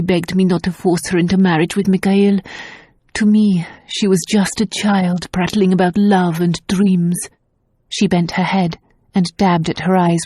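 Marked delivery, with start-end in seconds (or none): none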